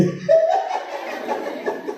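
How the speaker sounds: high breathless giggle; high laugh. Real, not acted. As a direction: laugh at your own joke. Laughing at own joke.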